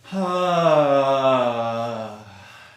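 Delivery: long, loud sigh sliding downward; voiced sigh. Shown as a demonstration. Teacher showing the sigh option, long voiced sigh. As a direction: breathe in, then release a big open "haah". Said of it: "breathe in and do a sigh".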